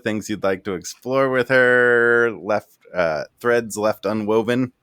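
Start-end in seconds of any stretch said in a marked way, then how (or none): none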